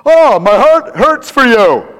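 booming, drawn-out mock sympathy; loud and boisterous. Preacher parodying a compassionate person, loud and boisterous. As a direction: wail it slow, high and very loud, hammed-up. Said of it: "wouldn't necessarily be loud and boisterous".

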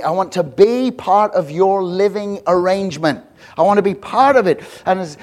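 high, loud, driving pulpit delivery; impassioned preaching. Real, not acted. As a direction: press each phrase home to the congregation. Preaches, voicing Jesus to Zacchaeus.

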